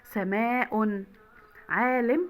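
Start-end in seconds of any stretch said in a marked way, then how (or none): 0.0-1.0 slow سماء ending in -un; تنوين بالضم. Shown as a demonstration. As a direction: stretch سماء to a final -un. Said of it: "وبالضم". (نطق سماء ببطء بضمتين في آخرها)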